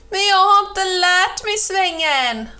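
high, loud wail; helpless cry just before the crash; panicked shout. A narrator's reading. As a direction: protest in rising alarm. Cow panics before falling in ditch.

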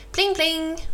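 high ringing bell imitation; bell pling. Narrator imitating the cow's bicycle bell, high and ringing. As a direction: chime it out like a little bell.

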